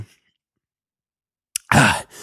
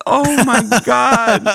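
laughing; 10 semitones higher, slower than usual. Real, not acted. Reacting to the post.

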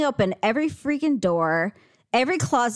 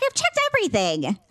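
higher, mock-exasperated comeback; exasperated retort; 8 semitones higher than usual. Wife answering husband about the closet, high exasperated retort. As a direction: insist the job is done.

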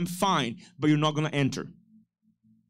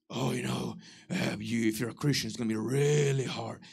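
lower, softer whining doubter; mock naysayer; 7 semitones lower, 6 dB quieter than usual. Pastor mimicking a discouraging voice, low and mopey. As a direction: mumble it like a gloomy doubter.

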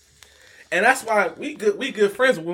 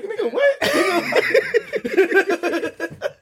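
mock outrage; 12 semitones higher, much slower than usual. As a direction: play it as a fake-outraged squeak. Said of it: "And I'm like".

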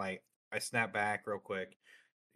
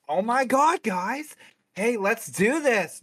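much higher, louder, over-bright camera voice; fake streamer hype; 11 semitones higher, 9 dB louder, slower than usual. Two-faced streamer on camera, fake excited hype. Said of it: "they're like".

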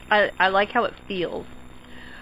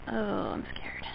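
scared whisper; 16 dB quieter, slower than usual. Real, not acted. Whispering fear before sour candy.

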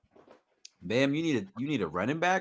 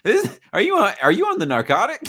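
teasing disbelief; 9 semitones higher, 5 dB louder, faster than usual. Real, not acted. Teasing friend about bad offer.

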